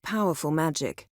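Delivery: ordinary talk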